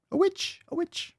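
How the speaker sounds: very high, squeaky little cry; mock witch-hunter cry. Streamer mimicking someone spotting a witch, mock high-pitched cry. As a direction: squeal it in a tiny falsetto.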